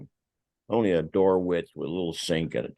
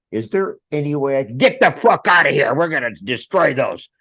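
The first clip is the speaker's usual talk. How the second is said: loud, higher demand to leave; cheeky loud plea; 7 semitones higher, 7 dB louder than usual. Retold or imitated. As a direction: push back at the officers boldly. Pleading to get out.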